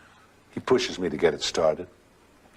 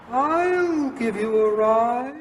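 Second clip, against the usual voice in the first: very high, drawn-out falsetto; effeminate voice; much slower than usual. Retold or imitated. Mysterious stranger offers a walker a ride, high effeminate voice. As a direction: float it out slowly in a high effeminate voice. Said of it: "I hear an effeminate voice".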